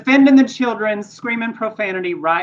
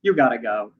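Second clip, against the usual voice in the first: security guard urging; 10 semitones lower, 4 dB quieter than usual. Retold or imitated.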